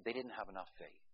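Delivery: mocking faith healer. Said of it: "what does a faith healer say".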